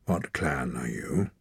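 low, slow chieftain's question; stern clan leader. A narrator's reading. Clan leader questioning a stranger, low and slow. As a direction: interrogate the boy coldly.

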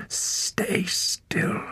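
sharp hissed whisper. A narrator's reading. Captor hissing at Torak to keep still, hissed. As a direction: hiss the order. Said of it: "hissed his captor".

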